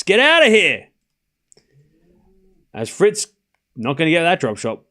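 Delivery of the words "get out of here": loud, raised-pitch dismissal; exasperated shout. Real, not acted. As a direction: yell it to chase them off. Outburst at bots during commentary.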